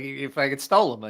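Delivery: usual speaking voice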